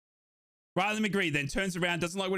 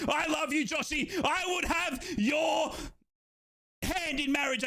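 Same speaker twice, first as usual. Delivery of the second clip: higher, half-shouted mock declaration of love; gushing mock proposal; 7 semitones higher than usual. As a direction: propose to the striker in jest.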